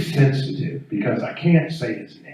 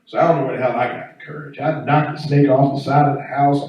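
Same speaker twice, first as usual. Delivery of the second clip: normal talking voice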